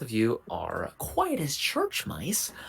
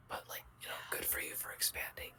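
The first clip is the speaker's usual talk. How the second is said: whispering; 9 semitones higher, 9 dB quieter, faster than usual. Real, not acted. Players whispering during stealth scene.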